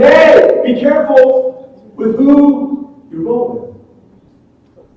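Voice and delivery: high, loud mock God warning. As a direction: warn them loudly like God from above, slowly. God voiced loudly, mock.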